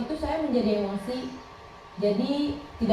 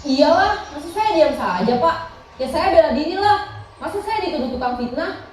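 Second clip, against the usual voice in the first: louder, higher self-defence; indignant; 4 semitones higher, 5 dB louder than usual. Acted. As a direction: protest as if wrongly accused, voice raised. Acted pupil defends herself, aggrieved.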